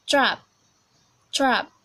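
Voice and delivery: demonstrated British "trap"; British accent. Shown as a demonstration. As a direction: say "trap" in British English, short and open. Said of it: "we are going to British English first".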